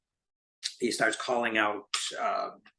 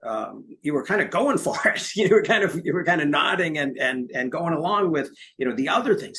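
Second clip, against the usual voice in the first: higher, louder, teasingly pressing; old man pressing; 6 semitones higher, 4 dB louder than usual. Retold or imitated. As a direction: push him on it with a laugh. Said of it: "And he says".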